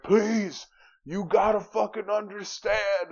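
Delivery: high, loud mock plea; hammed-up desperate begging; defeated fighter begging. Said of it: "he's like".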